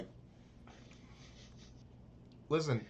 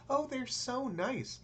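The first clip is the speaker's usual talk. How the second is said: much higher, slow, gushing; mock gushing tourist; 10 semitones higher, much slower than usual. Mocking a tourist who praises locals, high and gushing. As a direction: coo it like a naive tourist. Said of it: "mocking naive tourist".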